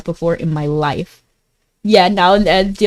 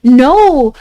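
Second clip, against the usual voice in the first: louder, higher emphatic "no"; loud protest; 4 semitones higher, 8 dB louder than usual. Real, not acted. Streamer rebutting chat's high-heels tease, loud protest.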